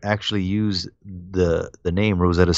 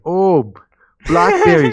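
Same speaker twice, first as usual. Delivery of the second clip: hammed-up Boston accent, pitched way up; mock Boston accent; 10 semitones higher, 4 dB louder, slower than usual. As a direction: gush over blackberries like a cartoon Bostonian. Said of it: "I don't know if Bostonians talk".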